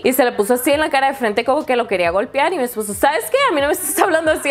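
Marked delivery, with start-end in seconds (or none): 2.9-4.5 higher-pitched indignant retort, imitated; firm confrontation. Husband stands up to the locksmith, mock indignant. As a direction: act out standing up to someone.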